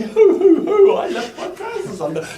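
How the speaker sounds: hooting laugh, high and loud; hammed-up laughing at yourself; mock laughing along. Lecturer pretending to laugh at his undone trousers, hooting loudly. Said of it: "Join in the laughter and say".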